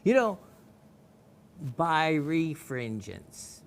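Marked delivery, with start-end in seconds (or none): 1.8-3.6 "birefringence" stretched out syllable by syllable; exaggeratedly slow pronunciation of "birefringence"; real slow. Shown as a demonstration. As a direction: say "birefringence" very slowly, each part drawn out. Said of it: "read this real slow".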